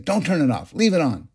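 child's demand, only lightly imitated; mild mock child yell. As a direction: retell the boy's insistence lightly. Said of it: "he yells at me".

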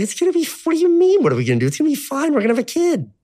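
put-on brushing off worry like a carefree kid; naive cheery reassurance. Teen dad reassuring his pregnant girlfriend in the car, high and breezy.

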